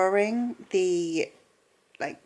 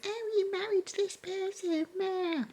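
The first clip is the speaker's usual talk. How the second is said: high, whiny mock tantrum voice; hissy fit; 6 dB quieter than usual. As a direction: imitate a sulky daughter whining. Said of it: "throwing a hissy fit".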